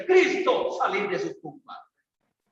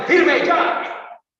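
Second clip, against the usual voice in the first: loud, sharp police chief's order, higher; commanding shout; 8 semitones higher, 6 dB louder than usual. Acted. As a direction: bark the order at the ranks. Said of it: "Antes, para formarle".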